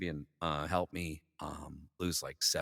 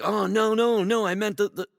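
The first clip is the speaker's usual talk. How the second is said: high, loud mimicked backpedalling; flustered boss; 10 dB louder than usual. Boss explaining which Dudley he meant, high flustered voice. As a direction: stammer out a loud mock protest.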